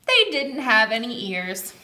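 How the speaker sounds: ordinary delivery, nothing marked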